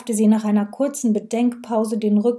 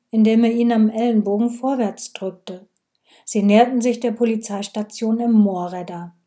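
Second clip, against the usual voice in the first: plain reading voice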